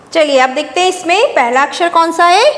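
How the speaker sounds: usual speaking voice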